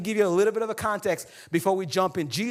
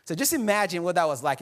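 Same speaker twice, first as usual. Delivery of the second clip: ordinary talk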